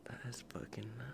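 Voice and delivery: whispering. Real, not acted. Shocked aside.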